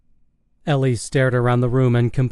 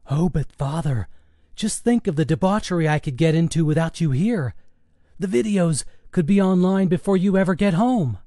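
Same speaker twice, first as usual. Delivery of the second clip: higher-pitched mock-innocent son; cheeky son winding up his father; teasing teenage son; 5 semitones higher than usual. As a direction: tease Dad in a raised, put-on son's voice.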